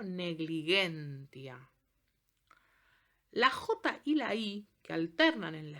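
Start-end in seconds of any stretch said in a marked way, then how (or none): 0.0-1.7 negligentia said slowly with hard G, neg-li-GEN-tia; slow Latin pronunciation. Shown as a demonstration. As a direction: spell out "negligentia" syllable by syllable, G hard. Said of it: "La G suena G".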